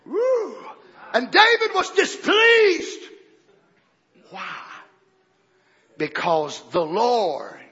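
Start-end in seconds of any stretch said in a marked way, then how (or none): 1.1-3.0 slow, loud, high proclamation; shouted scripture. Real, not acted. Preacher proclaiming the verse to the congregation. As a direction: drag out every word.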